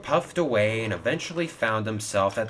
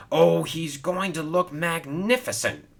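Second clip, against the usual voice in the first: boasting; 8 semitones higher than usual. A narrator's reading. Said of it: "boasted Hero".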